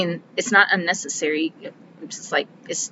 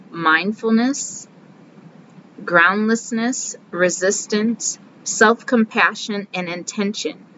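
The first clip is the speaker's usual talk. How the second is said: each key term spoken slowly and deliberately; slow list of terms; slower than usual. Shown as a demonstration. Reading the book's key concepts to viewers, slowly. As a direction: lay out each concept carefully, one at a time.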